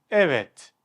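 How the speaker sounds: ordinary talk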